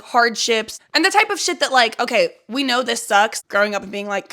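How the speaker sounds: ordinary talk, nothing marked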